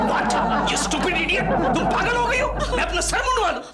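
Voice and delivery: high-pitched, outraged protest; indignant outburst. Acted. Husband told by wife to shave his head, indignant outburst. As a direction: shoot back in high, incredulous indignation.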